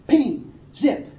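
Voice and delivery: sharp imitated ricochet noises; bullet sounds. Preacher telling Gallipoli rescue, imitated bullet pings. War story, imitated gunfire.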